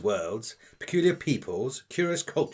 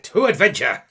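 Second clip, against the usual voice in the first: loud, higher battle cry; rousing cheer; 6 semitones higher, 10 dB louder than usual. Acted. Alceste rallying the crew to set off, rousing cheer. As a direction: cheer it like a toast.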